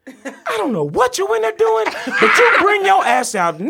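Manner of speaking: high, loud furious mother; furious mom yelling. Retold or imitated. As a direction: yell like an enraged mom. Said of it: "loud bang on the door".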